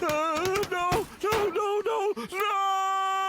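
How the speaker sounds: the signal is gone, so crying out no in despair. Acted. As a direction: beg no until it cracks into a wail.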